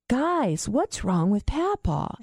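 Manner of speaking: put-on high, slow worried child; worried kid. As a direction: ask it like a puzzled child. Child worried about grandpa.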